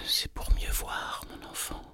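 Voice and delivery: soft whisper fading to nothing; whispering wolf. A narrator's reading. Wolf posing as grandmother answering the girl, whispered voice. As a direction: whisper the reply, letting it die away.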